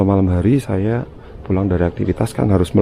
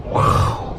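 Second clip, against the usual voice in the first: awed whisper. Real, not acted. Awed on seeing the door.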